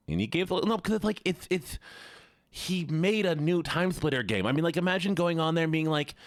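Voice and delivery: high, incredulous lisping nerd. Pretend. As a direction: rant like an amazed lisping nerd. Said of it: "I still have this voice more".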